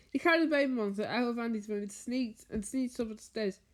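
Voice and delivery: usual speaking voice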